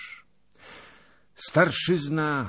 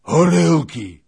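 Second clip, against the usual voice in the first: high, drawn-out hoarse cry; loud raspy holler; hoarse shout; 12 semitones higher, 16 dB louder, slower than usual. A narrator's reading. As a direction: bellow hoarsely for a drink. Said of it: "zakrzyknął chrapliwie".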